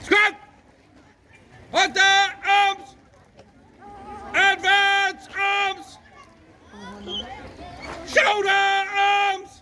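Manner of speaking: high, drawn-out parade ground calls; barked firing squad commands. Acted. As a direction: bark each drill order slowly.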